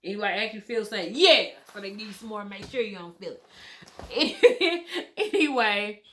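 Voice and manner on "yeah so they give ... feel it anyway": loud burst of laughter; laughing. Real, not acted. Telling viewers about the numbing, breaking into loud laughter.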